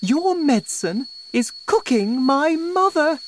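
high, loud wife voice; high-pitched, distraught blame; Missus Cranky, accusing. A narrator's reading. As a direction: accuse the husband in panic.

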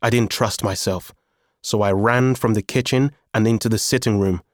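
plain reading voice

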